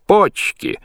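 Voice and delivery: very high, loud yell; shout. A narrator's reading. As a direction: shriek it out with delight. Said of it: "Лоцман кричит".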